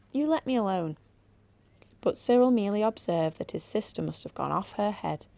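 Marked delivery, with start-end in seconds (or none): 0.1-1.0 imitating creature. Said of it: "it said something".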